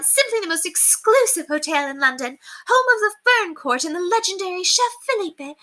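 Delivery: high, condescending society chatter; high, gossipy mistress; snobbish gushing lady. Acted. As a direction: brag down to the maid. Lady Constance gushes snobbishly.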